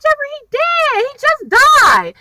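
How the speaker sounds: high, stunned mock panic; alarmed disbelief. Telling panicking Jeffrey he's dead.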